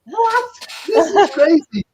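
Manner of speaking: very high incredulous what; retold shock. Dealer reacting to the dice trick, shocked. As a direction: squeak out the disbelief. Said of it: "I was like".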